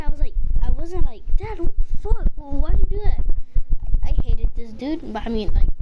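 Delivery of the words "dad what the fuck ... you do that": retold protest. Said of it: "I wasn't mad".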